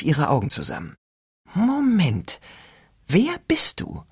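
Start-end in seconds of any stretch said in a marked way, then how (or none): 1.5-4.0 suspicious high whisper. A narrator's reading. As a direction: ask warily, in a whisper.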